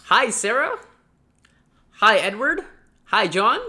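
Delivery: loud, high, beaming hello to each name; bright eager greeting. Shown as a demonstration. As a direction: greet them like you're thrilled they came. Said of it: "just be like".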